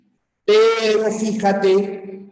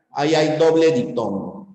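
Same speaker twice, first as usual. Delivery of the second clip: ordinary talk, nothing marked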